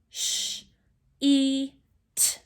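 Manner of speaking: "sh, e, t" as three separate sounds with gaps; sounded out. Shown as a demonstration. Teacher counts sounds in sheet, segmented slowly. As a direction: break "sheet" into sh, e, t. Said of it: "How many sounds are in the word".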